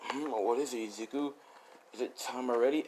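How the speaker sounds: soft, half-awake mumble; sleepy dad. Retold or imitated. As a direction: murmur it as if barely awake. Voicing just-woken dad.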